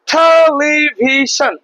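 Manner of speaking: Television slowly stretched as one connected word; whole word, drawn out. Shown as a demonstration. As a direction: draw out television without breaking it up. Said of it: "ভাগ করে, ভাগ করে আমরা পড়তে পারবো".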